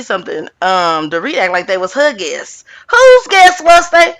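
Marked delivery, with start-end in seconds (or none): 2.9-4.1 high, loud, incredulous demand; incredulous challenge. Real, not acted. Host challenging Dorit's claim about the guests, loudly incredulous. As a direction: call it out high and loud, in disbelief.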